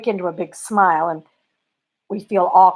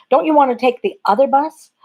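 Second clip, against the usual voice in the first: lightly voiced Southern driver, pitched up; higher, questioning Southern drawl; 7 semitones higher, 4 dB louder than usual. Bus driver questioning a passenger's choice of bus, light Southern drawl. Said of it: "in that lovely Southern drawl".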